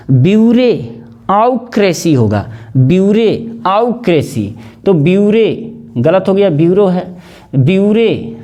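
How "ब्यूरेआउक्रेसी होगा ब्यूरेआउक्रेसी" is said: ब्यूरेआउक्रेसी slowly split as "byure... au-kresi"; ब्यूरेआउक्रेसी in slow memory chunks; spelling pronunciation. Shown as a demonstration. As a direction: say "re", not "ro", then a full "au" in ब्यूरेआउक्रेसी. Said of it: "ब्यूरो नहीं पढ़ना पड़ेगा".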